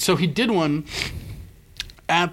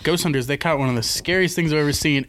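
normal talking voice

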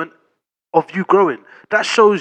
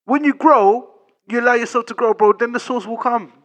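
excited; 6 semitones higher, 5 dB louder than usual. Real, not acted. Host heats up arguing the point.

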